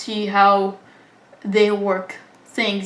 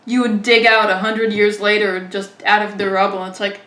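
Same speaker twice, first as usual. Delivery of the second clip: usual speaking voice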